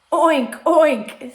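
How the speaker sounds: high, loud oinking; pig oink. A narrator's reading. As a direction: squeal out short oinks like a pig. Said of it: "said the pig".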